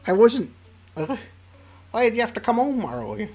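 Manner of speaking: put-on a caught-out girl fumbling for excuses; flustered Cookie. Cookie, her surprise spoiled, complaining flustered to Sam.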